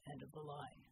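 low, quiet, soldier's voice; low gruff soldier. A narrator's reading. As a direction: keep it low and steady. Bull's low voice continues.

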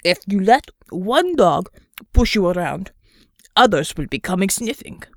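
put-on Russian accent with mouth full. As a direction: talk around a mouthful of ravioli. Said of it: "speaking around another mouthful of ravioli".

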